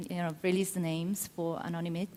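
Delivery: ordinary talk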